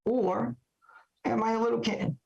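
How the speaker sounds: usual speaking voice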